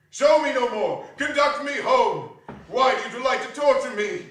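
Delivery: loud, pleading and desperate; anguished plea. A narrator's reading. Scrooge begging the ghost to take him home, anguished. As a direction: beg the spirit to stop.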